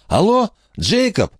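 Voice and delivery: a high, eager hello down the line; Horace on the phone. Acted. Horace calls over the phone.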